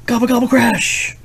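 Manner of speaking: high loud gobbling then crash; turkey gobble crash. Acted. Parent acting out crashing turkeys in a picture book. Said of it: "Turkeys landed with a thump".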